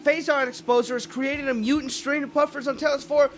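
high, loud panicked read-through. Real, not acted. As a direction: rattle off the text in alarm. Reading game text in panic.

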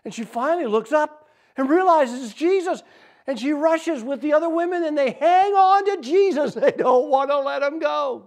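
high, loud, impassioned storytelling; excited climax. A narrator's reading. Preacher telling how women cling to Jesus, excited climax. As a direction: tell it at full, elated pitch.

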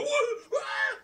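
high startled double what; confused fans. Retold or imitated. Fans caught off guard, asking what happened. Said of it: "the fans go".

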